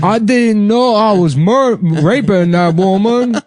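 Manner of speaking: high, slow dopey voice; hammed-up dopey inmate; mock simpleton. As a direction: drag it out, playing dumb. Voicing inmates playing dumb.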